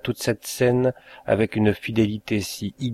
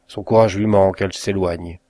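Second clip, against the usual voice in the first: usual speaking voice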